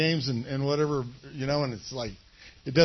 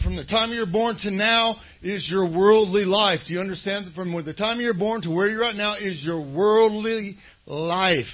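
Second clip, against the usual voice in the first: loud, rising preacher's exhortation; fiery sermon voice; full-force preaching; 2 semitones higher, 8 dB louder than usual. Acted. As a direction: drive it home to the congregation.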